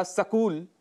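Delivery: सकूल as "sa-kool"; mispronunciation splitting the cluster. Shown as a demonstration. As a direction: slip a short a between s and k: सकूल. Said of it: "जो कि गलत है". (सकूल, स और क अलग करके गलत उच्चारण)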